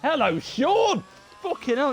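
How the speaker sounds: high, loud, slow drawn-out hello; excited greeting. Real, not acted. Streamer greets his friend Sean arriving in chat, excited. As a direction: hail the friend like a long-lost mate.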